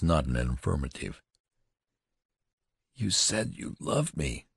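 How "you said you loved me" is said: slow, softer, stunned accusation; hurt disbelief, slowly; wild cry, held back. A narrator's reading. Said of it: "she cried wildly".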